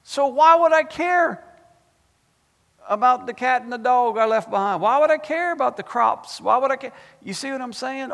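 high, loud, excited preacher; fired-up preaching. Real, not acted. Preacher asking why he'd care what's left behind, fired-up preaching. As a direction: preach it high and hard, voice lifted.

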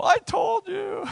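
high, slow, shouted gloat; mock triumphant squeal. Mocking a scripture picker, high triumphant shout. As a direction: crow it like a smug winner.

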